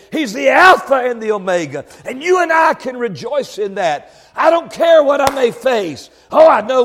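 high, loud pulpit delivery; full-force preaching. Real, not acted. Preacher assuring the congregation through their troubles, full-force preaching.

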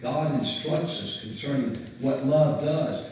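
ordinary talk, nothing marked

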